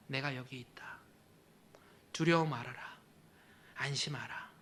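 very soft, hushed comfort; tender whisper. Acted. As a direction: breathe the words gently. (예수님이 제자들에게 속삭이듯 다정하게)

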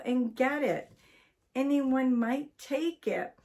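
incredulous start, then bossy orders; indignant scolding. A narrator's reading. Wife scolds husband over umbrella.

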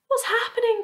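very high, startled question; alarmed cry. A narrator's reading. James woken by the heaving floor, alarmed cry. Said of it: "cried James".

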